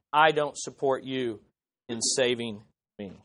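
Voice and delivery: soft, low, humble prayer. Pretend. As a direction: pray it quietly, grateful. Humble prayer voiced softly.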